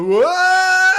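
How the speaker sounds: piercing high yelled What; Wayne's World shout. Retold or imitated. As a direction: shriek it like Wayne and Garth's close-up. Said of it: "they do the".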